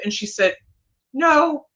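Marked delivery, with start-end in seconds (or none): 1.1-1.7 put-on higher and louder, a child's blunt answer; voicing a little girl. The lost girl telling the psychic her mommy won't miss her, in a high child's voice. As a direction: pipe up like a small girl, matter-of-fact.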